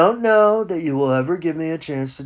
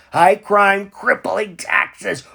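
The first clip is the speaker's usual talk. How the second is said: ordinary talk, nothing marked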